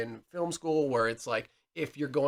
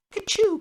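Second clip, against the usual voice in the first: much higher kachoo rise; gunshot imitation; 4 dB louder than usual. Shown as a demonstration. Friend imitating a fake movie gunshot, high. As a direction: make a cheap movie gunshot sound. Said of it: "it's not".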